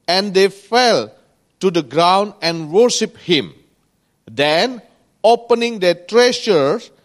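loud, projected Bible reading; scripture reading. Acted. Preacher reading Matthew on the wise men, loud scripture reading. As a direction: declaim the verse phrase by phrase. Reads the verse aloud.

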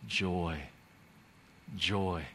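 low, soft, separate words; hushed contentment; savoured joy. Retold or imitated. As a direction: let each word settle quietly. Savouring the relief felt.

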